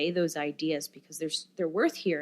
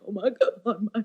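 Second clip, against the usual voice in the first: higher, choked-up mock exclamation; imitating teary dads; 3 semitones higher, 3 dB louder than usual. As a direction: act out an overwhelmed, tearful father. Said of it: "like, teary and like".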